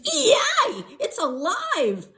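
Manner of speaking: high, loud shriek of glee; man shrieking that the doll lives; excited scream. Acted. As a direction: scream it with crazy delight. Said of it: "the man screamed".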